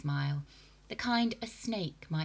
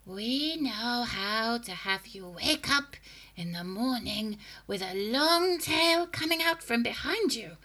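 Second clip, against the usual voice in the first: menacing witch voice; 5 semitones higher, 5 dB louder than usual. A narrator's reading. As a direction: gloat over the horror. Witch threatening.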